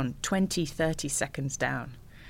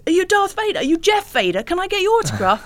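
high, loud fanboy voice; starstruck fan; 8 dB louder than usual. Retold or imitated. Fan in the canteen queue gushing at Vader, high-pitched. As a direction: gush like an excited fan in a queue. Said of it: "the next guy in the queue comes up and says".

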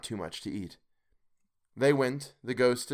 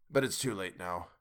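softer, lower regretful mutter; 4 semitones lower, 6 dB quieter than usual. A narrator's reading. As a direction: mumble it away into the cuff. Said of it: "Scrooge muttered".

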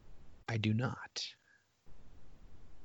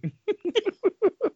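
high laugh. Real, not acted. Laughing after potpourri joke.

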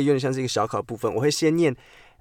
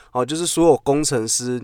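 ordinary talk, nothing marked

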